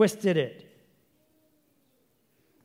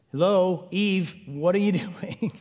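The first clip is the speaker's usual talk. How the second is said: mock incredulous; 4 semitones higher, 3 dB louder than usual. Preacher mocks Eve's choice.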